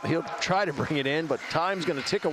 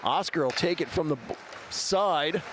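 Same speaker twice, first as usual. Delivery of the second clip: live hockey play-by-play; 2 semitones lower than usual. Acted. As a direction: call the action live. Play-by-play of game.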